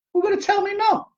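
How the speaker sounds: sudden high squeaky voice, teasing; high falsetto tease: who gonna tell me no; playful squeak. Pretend. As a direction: squeal the question like a cheeky kid. Playful rhetorical challenge.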